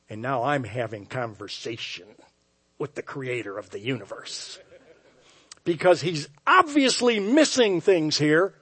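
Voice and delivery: ordinary talk, nothing marked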